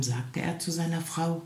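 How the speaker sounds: usual speaking voice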